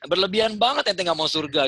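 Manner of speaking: mocking rebuke. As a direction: tease him for overdoing piety. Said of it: "saya bilang gitu ya".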